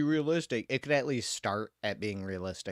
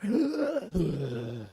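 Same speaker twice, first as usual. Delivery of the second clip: hammed-up gasp; 6 semitones higher than usual. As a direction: gasp like he's winded after a few steps. Game character out of breath.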